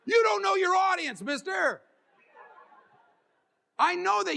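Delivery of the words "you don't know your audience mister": loud, much higher call-out; shouting. Acted. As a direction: shout the punchline at the room. Punchline shouted at crowd.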